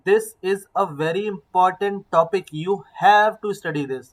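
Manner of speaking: very slow, stretching "important" and "have"; stretched stress. Shown as a demonstration. Teacher to class, showing stress with a very slow "important" and "have". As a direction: drag out "important" and "have" so they stand out. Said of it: "थोड़ा मैंने उसको, word को खींचा".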